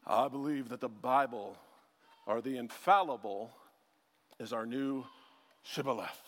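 low voice, weighty pauses; solemn explainer. Retold or imitated. A guy explaining infallibility, mock-solemn. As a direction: intone it low, phrase by phrase, mock-grave. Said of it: "what he ends up explaining is".

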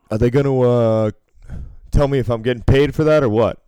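mock pushy demand. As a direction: push the dealer for an answer. Retold pushy gambler demands payment.

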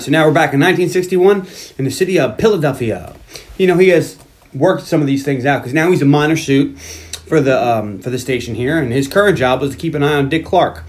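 normal talking voice

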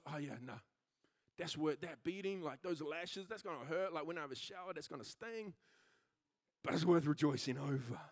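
imitated prisoners' low, soft murmur; low, subdued musing. Prisoners reckoning with their lashes, low and subdued. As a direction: drop right down, quiet and low, as if weighing the pain. Voices battered prisoners talking quietly.

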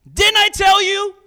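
high, loud shout of told-you-so; furious king shout. Acted. King of Israel to Jehoshaphat, loud high shout. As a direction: yell it at Jehoshaphat.

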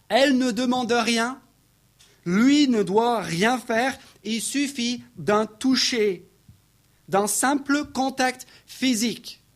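high, insistent preacher's voice; impassioned preaching. Real, not acted. Preacher marvelling at the woman's faith, impassioned.

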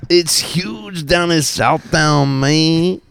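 slow, high mock Mexican accent; hammed-up Chicano accent, stretched slow. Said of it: "But they talk like that".

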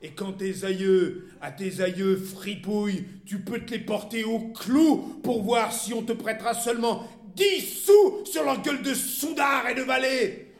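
tirade building to high, loud shouting; furious abuse. A narrator's reading. Wife banishing her husband to his gambling dens, furious abuse. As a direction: hurl each insult, dragging it out at full voice. Wife's final screamed insults.

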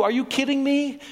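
ordinary delivery

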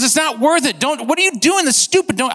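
high, urgent imitation of a pleading friend; acted alarmed protest. Friend trying to stop the hunter, acted alarm.

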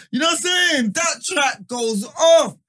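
loud, high, hyped-up shout. Real, not acted. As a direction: yell it like the track just dropped. Hyped about the soca track.

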